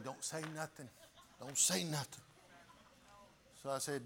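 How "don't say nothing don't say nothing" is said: very quiet, urgent hushed warning. Retold or imitated. Brother urging him quietly, hushed warning. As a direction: whisper it so Daddy won't hear. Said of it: "he's telling me".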